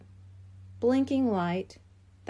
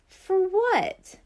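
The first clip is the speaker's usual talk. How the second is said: skeptical question; 9 semitones higher, 4 dB louder than usual. A narrator's reading. Brain skeptical, arms folded.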